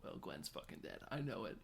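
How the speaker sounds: whispered, flat certainty; grim whisper. Retold or imitated. Nancy sure her boyfriend is dead, whispered. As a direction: breathe it out, resigned. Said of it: "Nancy just being like".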